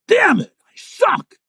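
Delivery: high, loud mock frustrated yell; hammed-up outburst of self-disgust. Farley throwing off the wig, yelling in disgust. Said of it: "tears off his wig in disgust and yells".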